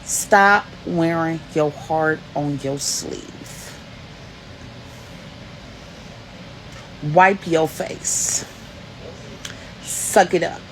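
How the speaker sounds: slow, firm, no-nonsense lecture; a stern woman telling a kid to toughen up; stern elder's rebuke. Retold or imitated. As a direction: lay down the law to a crying teen, slowly. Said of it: "and she said".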